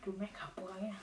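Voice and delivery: whispered taunt. Real, not acted. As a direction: hiss it teasingly. Teasing insult.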